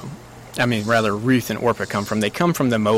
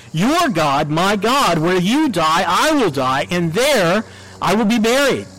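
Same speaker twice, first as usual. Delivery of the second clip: higher-pitched, impassioned vow; powerful declaration; 6 semitones higher than usual. Acted. Ruth vowing to Naomi that she will stay, powerful declaration. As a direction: declare it with rising force, pitched high. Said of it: "this very powerful statement that she makes".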